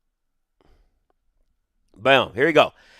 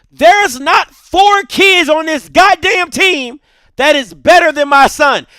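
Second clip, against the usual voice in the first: high, loud indignant rant; pissed off; 5 semitones higher, 5 dB louder than usual. Retold or imitated. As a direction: re-enact a fed-up dad's outrage, hammering the words. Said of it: "so now I'm pissed".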